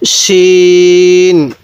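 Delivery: শিন held very long with a full thick sh; thick shin. Shown as a demonstration. Teacher models Arabic shin, thick and very slow. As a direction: draw out শিন heavy and thick. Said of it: "এই হরফটার নাম কি?".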